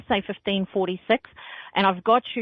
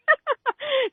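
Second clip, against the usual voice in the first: a quick high laugh; high burst of laughter. Real, not acted. Psychic laughs after joking about sacrificing a person, high laugh. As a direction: laugh at your own dark joke.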